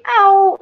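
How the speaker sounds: sudden high yelp of pain, loud; boy stung by a bee, loud high yelp; cry of pain. Retold or imitated. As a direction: yelp like you've just been stung. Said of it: "and then he said".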